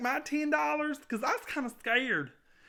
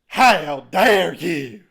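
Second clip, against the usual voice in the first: very slow, much louder, lower outrage; outraged boss; 7 semitones lower, 12 dB louder, much slower than usual. Acted. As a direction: drag out each word in fury.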